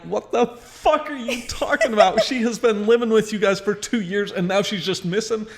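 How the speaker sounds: high-pitched incredulous retort; worked-up, high retelling of outrage; retold outrage. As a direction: re-enact a disbelieving challenge on the phone. Acting out outraged phone reply.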